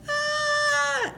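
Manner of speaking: very high drawn-out squeal; long falsetto "aah" of delight; mock excited squeal. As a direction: imitate onlookers squealing at a ring. Imitates women seeing the ring box.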